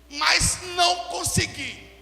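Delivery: loud, slow scripture declamation; forceful preaching. Real, not acted. Preacher quotes Corinthians to church, loud measured declamation. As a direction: drive each phrase out to the congregation.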